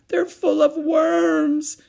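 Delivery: very high, loud, drawn-out wail; sobbing wail. A narrator's reading. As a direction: wail it like a tearful little girl. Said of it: "she sobbed".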